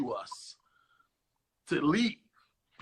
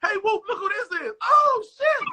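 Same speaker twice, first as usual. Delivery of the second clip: high, thrilled, half laughing; mock delighted surprise; 4 dB louder than usual. Star reacting to fans with high mock delight. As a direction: ham up the flattered celebrity. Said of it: "I'm like".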